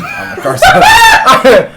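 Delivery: loud high laughing outburst; laughing shout. Real, not acted. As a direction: blurt it out cracking up. Laughing at own slip.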